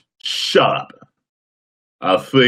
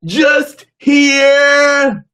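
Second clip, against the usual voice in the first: slow, much higher shouted Flair-style bellow; Jay Lethal doing Ric Flair; 6 dB louder, much slower than usual. Retold or imitated. Imitating a wrestler doing Ric Flair, drawn-out higher shout. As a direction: stretch it out into a big wrestling promo yell. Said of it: "Here's an impression of an impression".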